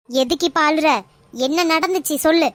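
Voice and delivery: usual speaking voice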